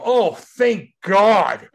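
loud, high, drawn-out exclamation; huge relief. Retold or imitated. Player retells hearing of the trade, loud relief. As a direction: let relief burst out, each word stretched.

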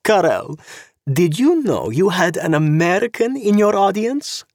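high, purring voice of a sharp-clawed society woman, mocking; sweetly catty, like an angry cat smiling; sly socialite. Zorena voiced by narrator.

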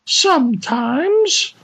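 slow, high timid lady; high woman voice. Acted. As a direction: admit it slowly like a lady. Woman voice answering.

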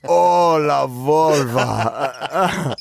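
very slow, stretched mock moan of "La Volva". Host drags out a comic moan of a made-up name, very slow. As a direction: draw the name out like a silly moan. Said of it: "as you were moaning".

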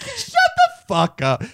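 very high, slow squealed yell; hammed-up furious outburst; mock road-rage scream. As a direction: stretch it out at the top of your voice range. Acted road-rage yell at car window.

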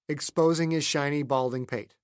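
ordinary delivery

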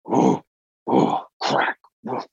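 puffed bear woofs and a bone crack; imitated woofing. Hunter imitating what he heard at the carcass, woofing. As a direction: huff like a bear, then mimic snapping bone. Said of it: "bones snapping and, like, woofing".

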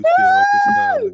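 very high loud shout; shouted falsetto. Pretend. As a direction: belt it out in falsetto.

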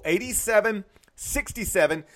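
normal talking voice

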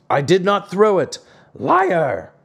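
high, emphatic storybook retorts; indignant quarrel. A narrator's reading. Old men quarrel over the thrown wood, acted indignation.

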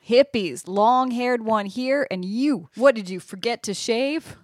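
slightly hammed-up southern drawl; southern accent. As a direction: needle the strangers in a southern accent. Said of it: "That's my southern accent".

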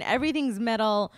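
ordinary delivery

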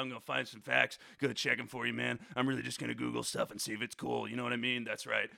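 Foxy promising to check facts, low and mellow; Foxy the fact checker. Pretend. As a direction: chill low guy voice. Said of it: "I wanna introduce you to Foxy".

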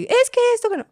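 a mother imitating her son's yelling; child's whine. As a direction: mimic a protesting little kid.

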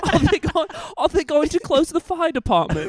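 put-on high, loud posh British child; mock posh eight-year-old English schoolboy. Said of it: "You look such a posh schoolboy".

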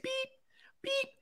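high sharp beeps like a heart monitor; imitated monitor beep. Son copying the hospital monitor, high beeps. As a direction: chirp the machine's beep. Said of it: "It's like".

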